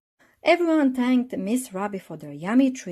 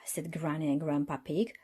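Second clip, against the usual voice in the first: ordinary delivery, nothing marked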